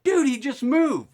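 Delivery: loud, very high shocked outburst; startled cry. Retold or imitated. Friend to caller on seeing grandpa move, startled. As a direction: yelp the news like a shocked buddy.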